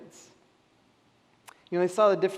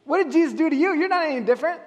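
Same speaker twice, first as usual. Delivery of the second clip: mocking challenge; 9 semitones higher, 6 dB louder, faster than usual. Skeptics challenge the believer again.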